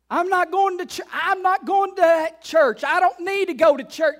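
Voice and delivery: high, loud mock defiance of church; defiant refusal. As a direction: shout it like a stubborn member who won't come.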